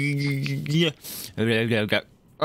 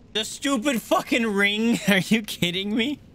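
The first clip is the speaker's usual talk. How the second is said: exasperated outrage; 10 semitones higher than usual. Real, not acted. Outraged at item weight.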